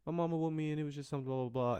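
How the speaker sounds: put-on high childish voice, squeaky and innocent; little kid voice. Kid brushing off the teacher's question in a high childish voice. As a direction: play your small self brushing it off. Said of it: "me being little".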